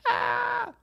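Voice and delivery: sudden high yelp of fright; startled shriek. Retold or imitated. Shrieking at a giant rat in the road.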